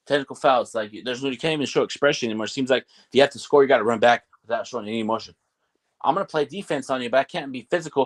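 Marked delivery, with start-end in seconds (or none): none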